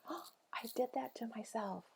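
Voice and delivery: breathy whisper to herself; hushed inner voice; whispered self-talk. Retold or imitated. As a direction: whisper it as a private realization.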